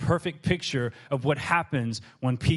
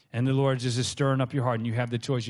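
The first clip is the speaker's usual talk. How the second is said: ordinary talk, nothing marked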